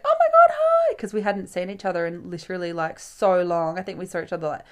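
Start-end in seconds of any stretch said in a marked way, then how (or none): 0.0-0.9 very high, loud imitated squeal; over-the-top squealed greeting; excited greeting. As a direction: mimic a thrilled friend's hello. Friend greets her on return.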